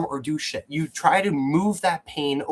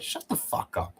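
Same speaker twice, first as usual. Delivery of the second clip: retold quote; 8 dB quieter than usual. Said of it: "And I said".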